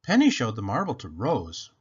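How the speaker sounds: ordinary delivery, nothing marked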